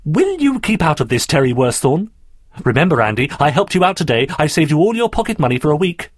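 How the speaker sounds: high, exasperated telling-off; insistent demand. Acted. Daphne pressures Andrew to reveal his secret, insistent. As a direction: press the point forcefully. Girl scolds, then bargains insistently.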